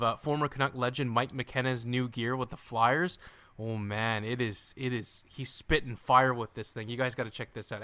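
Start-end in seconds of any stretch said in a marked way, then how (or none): none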